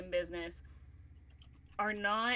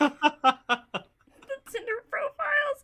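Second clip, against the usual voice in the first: laughing again; 12 semitones higher, 9 dB louder than usual. Real, not acted. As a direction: dissolve into laughter mid-phrase. Breaking up again.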